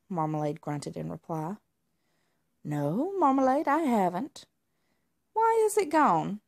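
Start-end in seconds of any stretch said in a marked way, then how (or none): 2.6-6.4 higher, gentle cat voice; friendly alley cat. Acted. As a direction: answer like a helpful friend. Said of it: "Dugbert replied".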